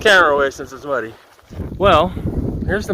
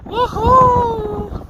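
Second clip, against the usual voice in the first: high, long whoop of triumph; excited woohoo. Real, not acted. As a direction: let out a joyful whoop. Cheering at the top.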